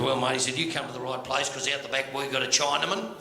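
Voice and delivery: low foreman's voice; low, matey sales pitch; camp foreman. Acted. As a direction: confide it to a mate, pitched well down. Foreman confides his offer in joke.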